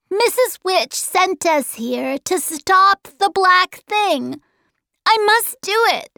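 put-on high, emphatic child's voice; determined boy. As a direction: insist on your mission in a high voice.

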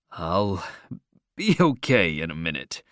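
put-on low-pitched boy recovering from nausea; low, queasy boy's voice. A narrator's reading. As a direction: drop low, as if feeling sick. Queasy boy after the ride.

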